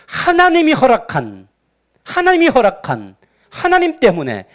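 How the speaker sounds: high, emphatic grievance; resentful blaming. Acted. Unhappy believer blaming God for misfortune, resentfully. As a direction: push the blame onto God, phrase by phrase. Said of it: "그 원망의 내용".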